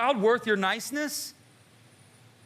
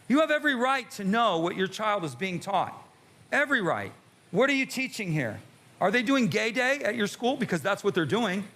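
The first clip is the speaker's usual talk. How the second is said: ordinary talk, nothing marked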